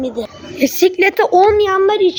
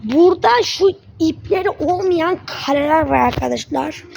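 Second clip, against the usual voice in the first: ordinary talk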